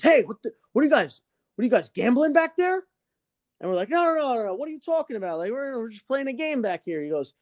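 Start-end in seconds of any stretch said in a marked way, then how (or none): 0.0-2.8 higher, mock-stern teacher calling across the class. Teacher catches kids gambling. 3.9-7.0 much higher, hammed-up innocent protest; innocent denial. Kids deny gambling to teacher.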